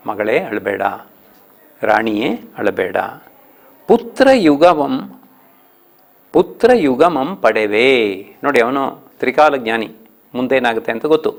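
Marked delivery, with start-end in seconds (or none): 3.9-8.3 slow verse reading, stress on ಪುತ್ರ; poem recitation. Shown as a demonstration. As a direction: draw out the verse, lifting ಪುತ್ರ. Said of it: "ಹದಿನಾರನೇ ಪದ್ಯ". (ಪುತ್ರ ಒತ್ತಿ ನಿಧಾನ ಪದ್ಯ ವಾಚನ)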